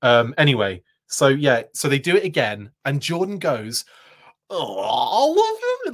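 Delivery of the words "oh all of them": higher, slow, hammed-up disbelief; over-the-top slow mock astonishment; even more ridiculous. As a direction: stretch it out, cartoonishly shocked. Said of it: "even more ridiculous than the first time".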